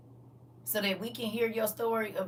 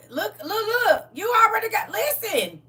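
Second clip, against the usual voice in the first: impassioned crying shout; 12 semitones higher, 11 dB louder than usual. Real, not acted. Preacher pleading at a climax.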